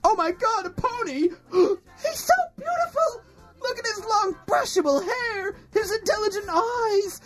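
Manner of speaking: high, gushing delight; overjoyed squeal. Acted. Overjoyed at birthday pony.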